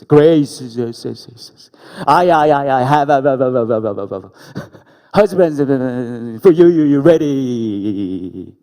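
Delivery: a loud, stuttering, echoing God announcing a husband; booming God voice. Retold or imitated. Pastor playing God in a dream, booming loud and stammering. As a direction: boom it out like a divine voice from the sky.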